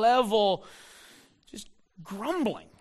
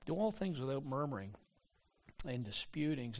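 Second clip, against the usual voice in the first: softer, hushed reading of the verse; quiet reflective rereading; 6 semitones lower, 11 dB quieter than usual. Real, not acted. Pastor softly repeats the verse to let it sink in.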